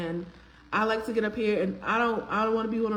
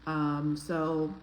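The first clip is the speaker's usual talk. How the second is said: usual speaking voice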